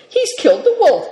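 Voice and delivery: high, loud, triumphant announcement; proud cry. A narrator's reading. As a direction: boast it out excitedly. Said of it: "cried Seven Stars proudly".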